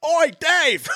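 high Aussie call across a distance; Australian calling from afar. Retold or imitated. Imitating a soldier hailing the corporal, distant Australian shout. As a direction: holler it in a put-on Australian accent. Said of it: "another Australian off in the distance".

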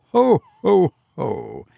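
high ho-ho falling away; acted laugh. A narrator's reading. Sexton over his Christmas box joke, laughing. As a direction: start the laugh high and let it drop.

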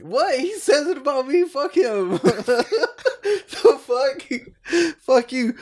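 high, laughing fake indignation; laughing mock outrage. Real, not acted. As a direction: pretend to be offended while laughing. Mock outrage at friend's remark.

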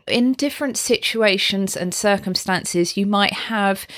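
normal talking voice

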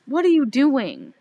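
loud, exasperated retold questioning; mock exasperation. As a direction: demand it like a baffled friend. Retold scolding of drunk woman.

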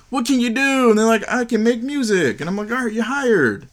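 very high-pitched skit voice; comic retelling in a squeaky-high voice; high mock dialogue. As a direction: act both sides way up high. Acts out hiring exchange on street.

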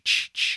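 unvoiced rhythmic ch sounds; whispered ch-ch music. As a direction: breathe the creepy music cue. Imitating the film's music.

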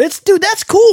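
high, bright exclamation; excited burst. Real, not acted. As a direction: burst out with sudden delight. Sudden enthusiastic burst.

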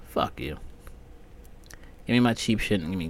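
usual speaking voice

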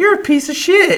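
very high, loud laughing insult; hammed-up indignant put-down; mock outrage. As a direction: squeal the insult in disbelief. Retells berating his friend.

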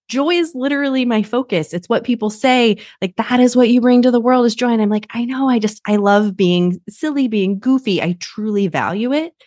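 high-pitched, insistent retelling of her own plea; earnest protest. Retold or imitated. Woman retelling to coach that joy is her focus, earnestly protesting.

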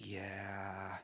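low, soft, drawn-out yeah; lazy boss drone, trailing away; Lumberg impression. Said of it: "do Lumberg while you do that".

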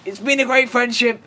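high, loud hammed-up character voice; mock farewell. Film character parting from a friend, hammed-up high declaiming. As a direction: declaim it loudly in a high put-on voice. Continues the acted farewell as Neil.

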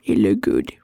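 "It look good" dropped very low; low, falling "good"; low-pitched repeat. Pretend. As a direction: sink right down on "look good". Repeats the phrase two contrasting ways.

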